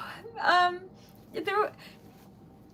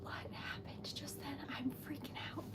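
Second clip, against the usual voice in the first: barely audible, stunned whisper; overwhelmed whisper; 20 dB quieter than usual. Real, not acted. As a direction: whisper it to yourself in disbelief.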